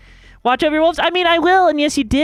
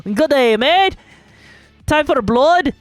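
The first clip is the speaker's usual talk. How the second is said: playful mock Australian accent, punched up; rough, jokey Australian accent; 2 semitones higher than usual. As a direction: greet them in a put-on Aussie accent. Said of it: "I honestly kinda like Australian accents".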